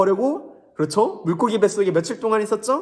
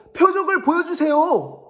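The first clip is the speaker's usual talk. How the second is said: much higher and louder, nagging repeat; whiny Pharisee; 11 semitones higher, 5 dB louder than usual. Acted. Pharisees pestering Jesus for a sign, whiny. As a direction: whine the demand again like a pest. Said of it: "바리새인들과 서기관들이 예수님께 말했어요".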